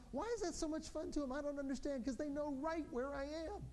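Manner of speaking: normal talking voice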